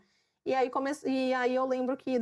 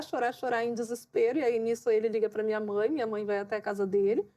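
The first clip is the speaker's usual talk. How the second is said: normal talking voice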